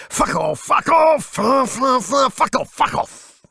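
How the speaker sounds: high, loud, half-sung yelps of swearing; drummer swearing at cymbals. Retold or imitated. Drummer Nico yelling at his cymbals mid-fill, wild high shouts. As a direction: belt it out like a manic drummer hitting each cymbal. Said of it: "they were always treated to special intimacies".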